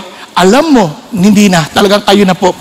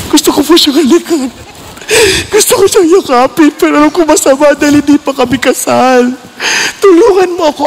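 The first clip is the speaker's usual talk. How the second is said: higher, crying confession to the Lord; tearful prayer; 4 semitones higher than usual. Retold or imitated. Young man confessing his desire to God, teary plea. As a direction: sob it out as a plea for help.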